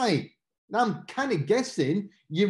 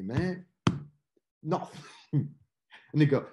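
ordinary talk, nothing marked

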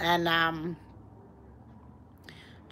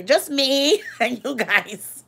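slow, giggling through the words; laughing; 5 semitones higher, 3 dB louder, slower than usual. Real, not acted. Telling stream viewers it's just them, laughing. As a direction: laugh as you say it.

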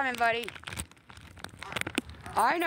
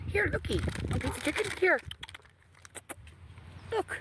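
much higher sing-song pet voice; 10 semitones higher than usual. Real, not acted. Owner coaxing a timid dog closer in high baby talk.

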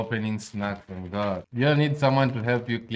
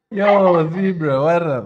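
big, high, loud greeting; calling out; 6 semitones higher, 10 dB louder, slower than usual. Real, not acted. Streamer greeting a newcomer on stream, calling out loudly. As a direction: hail someone at full voice. Hailing Zebra during the stream.

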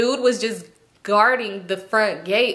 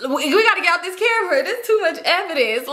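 high, stammered urgency breaking into laughter; hammed-up alarm, amused at yourself; mock panic; 8 semitones higher than usual. Retold panic over video, laughing.

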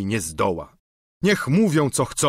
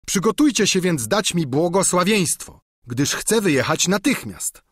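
a touch higher, livelier squire voice; lightly voiced eager peasant squire; simple squire pleading with his master; Sancho the squire; 6 semitones higher, 4 dB louder than usual.

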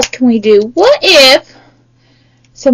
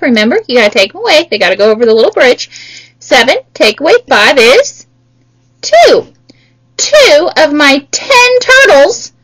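usual speaking voice

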